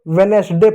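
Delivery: वेडनेसडे as ve-nes-day; three-syllable misreading. Shown as a demonstration. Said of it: "वेडनेसडे नहीं होता है". (तीन हिस्सों में "वेडनेसडे")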